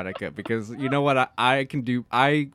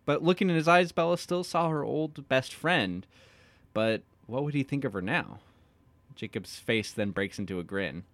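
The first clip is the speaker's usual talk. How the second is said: ordinary talk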